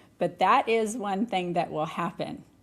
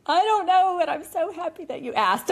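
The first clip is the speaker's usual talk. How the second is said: much higher, choked-up mock tearful voice; teary gratitude; 10 semitones higher, 4 dB louder than usual. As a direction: replay being moved to tears. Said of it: "I would tear up and be like".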